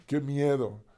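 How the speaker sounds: usual speaking voice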